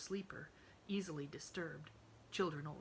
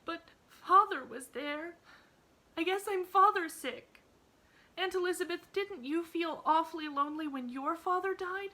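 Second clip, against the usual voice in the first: sobbing plea; 12 semitones higher, 9 dB louder than usual. A narrator's reading. Emily begs her aunt to understand her grief, sobbing. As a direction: cry out for understanding. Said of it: "sobbed Emily".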